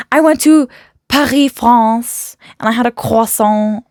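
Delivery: mock a pretentious name-dropper saying it the French way; condescending French pronunciation. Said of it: "the tone that they say it in".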